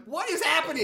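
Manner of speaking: loud, high mock bafflement; exasperated disbelief. Viewer yelling at the film, exasperated. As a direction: shout it in comic disbelief. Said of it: "I'm like".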